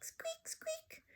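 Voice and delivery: high whispered squeak squeak; tiny squeaks. Acted. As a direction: squeak it small and high like a hamster. Hamster voice as heard.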